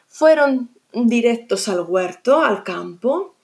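ordinary delivery, nothing marked

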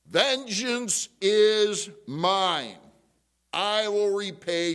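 slow, loud, high proclamation; thunderous declamation. Acted. Preacher quoting God on vengeance, thunderous. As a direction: declaim it slowly like divine judgement. Declaims God's words from scripture.

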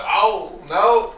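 high, loud wail of dismay; hammed-up distress; mock dismay. Host reacts to the sun going away, mock dismay. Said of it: "And it's just like".

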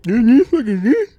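high, slow taunting squeal; mock high teasing voice. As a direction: squeal it out to tease. Teasing friend, exaggerated voice.

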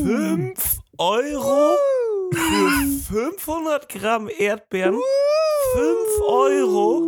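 high, slow, wailing outrage; outraged shock. Acted. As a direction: cry out at the price in disbelief. Outrage at strawberry price.

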